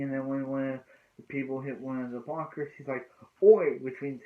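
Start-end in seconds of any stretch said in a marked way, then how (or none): 3.4-3.8 a sudden loud shout of Oi; retold shout. Teammate calls out at a blocker at derby practice, loud shout. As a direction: bark Oi out, sharp and high.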